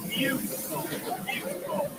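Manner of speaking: soft, fading into a whisper; whispered praise. Real, not acted. Poet complimenting a scarf, softly whispered. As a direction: breathe the compliment.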